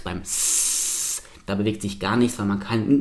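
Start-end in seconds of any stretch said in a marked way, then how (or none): 0.2-1.2 long voiceless "S"; snake-like hiss. Shown as a demonstration. Teacher showing still vocal cords, snake hiss. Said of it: "Während man beim Schlangen-S".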